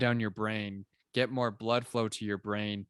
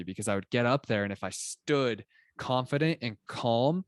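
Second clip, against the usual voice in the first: ordinary delivery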